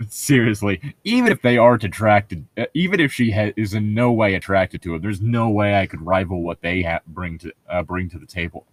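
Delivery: ordinary talk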